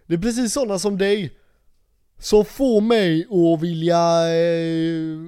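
loud, high, drawn-out retold tirade; angry police officer. Policeman telling off a drunk guy, angry and loud. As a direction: imitate an officer losing his temper, loudly. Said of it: "han blev så förbannad på mig".

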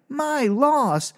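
high, slow incredulous repeat; can't believe what he just heard; squealing indignant what the hell; outraged disbelief. Retold or imitated. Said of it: "And I'm like".

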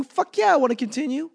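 high, loud, hammed-up eagerness; bursting to binge the next episode; mock eager yes. Answering the autoplay prompt, hammed-up eager and high.

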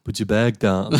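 put-on lightly done Schwarzenegger-style Austrian accent; mock Austrian-accented command; Arnie. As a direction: give the order with a hint of Austrian accent. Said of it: "it's actually not Arnie this time".

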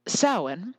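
ordinary delivery

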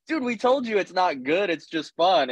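the group's reply pitched well up; mock high reply. Friends answer the upset friend in a high teasing voice. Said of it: "We're like".

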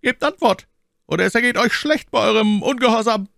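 high, loud imperious warning; commanding threat. A narrator's reading. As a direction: threaten them as their superior. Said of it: "mit demselben gebietenden Tone".

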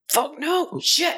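high-pitched pretend alarm; hammed-up high yelp of dread; mock panicked refusal. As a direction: squeak out a comic refusal. Acts out refusal to go.

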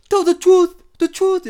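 loud high mock African accent, intense. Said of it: "even when you're trying to be intense".

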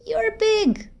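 put-on a little girl envying her big friend; small girl voice. Teddy's voice in the story.

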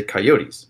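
"coyotes" said ky-OH-teez; three-syllable pronunciation. Shown as a demonstration. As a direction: sound the final -eez in "coyotes". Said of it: "You say coyotes".

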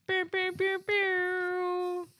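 high mock laser noises; pew pew sound effect. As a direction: fire off playful pew sounds.

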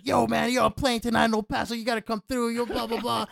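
retold gushing to a celebrity; hyped fan. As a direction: pitch it up like an overeager fan. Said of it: "he runs up to him and he starts".

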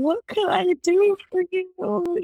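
high, slow, syrupy sympathetic voice; mock pity. Friend mocking pitying visitors at the door, high and slow. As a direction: parody a cloying sympathetic visitor.

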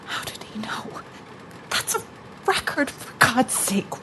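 very soft, voiceless disbelief; hushed, uneasy question; spooked whisper. Acted. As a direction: whisper it as if overheard. Spooked whisper after record answers.

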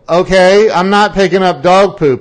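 mock-indignant refusal. His reply retold.

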